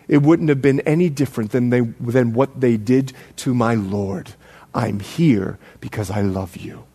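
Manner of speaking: low, soft, slowing to a hush; tender reply. Acted. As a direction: confess love quietly, acting the old apostle. John's tender answer, retold.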